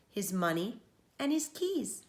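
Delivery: plain reading voice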